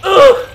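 sharp strained grunt; pained yell grunt; loud grunt. Real, not acted.

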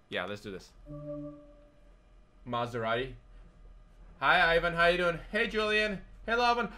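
ordinary delivery

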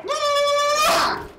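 very high, loud squeal; long high-pitched scream. Real, not acted. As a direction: shriek at the very top of your range. Screams during a fall in game.

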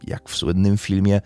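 steady, measured storytelling read; crime podcast narration. Acted. Host recounting a murder trial to listeners, in a steady narrative read. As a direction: lay out the court case like a documentary narrator.

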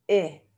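"E" dicho entre u y e, labios redondeados; Dutch u sound. Shown as a demonstration. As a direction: sonido "E" redondeado como la u holandesa. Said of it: "Así suena entre U y E". (vocal "E" entre u y e)

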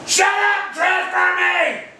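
high, slow yelled order; final enraged bellow; furious shout. Real, not acted. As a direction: bellow every word apart. Repeats demand louder.

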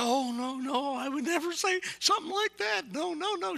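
high-pitched mock female voice; hurried, high protest as a woman; flustered woman imitation. Lady passenger reassuring the preacher beside her, high flustered protest. Woman hastily denying, retold.

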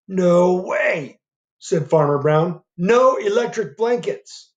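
lightly voiced refusal; stern farmer. Farmer Brown flatly refusing the cows' demand, mildly stern.

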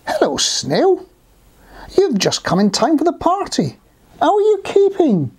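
high, animated storybook character voice; high, sing-out animal greeting; hearty greeting. Acted. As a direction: hail the newcomer to the party. Animal friend greets arriving snail.

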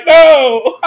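high, loud mock yelp of refusal; hammed-up frightened no; mock squeal. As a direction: squeal it like a scared hero. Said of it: "He's like".

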